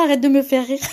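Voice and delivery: ordinary delivery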